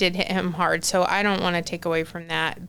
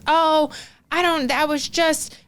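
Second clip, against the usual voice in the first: higher, louder, caught-out "oh"; retold panicked stammer; 8 semitones higher, 4 dB louder than usual. Fiancé with nothing to say when asked, mock flustered panic. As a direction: imitate someone fumbling for an excuse. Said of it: "panic mode".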